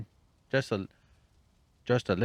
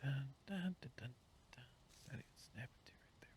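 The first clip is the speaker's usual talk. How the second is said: 1 whispered dun dun; 6 semitones higher, 15 dB quieter, faster than usual. Real, not acted. Whispered hum while building.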